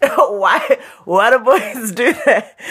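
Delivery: exasperated laughing question. Real, not acted. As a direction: laugh in disbelief. Laughing at boyfriend's behaviour.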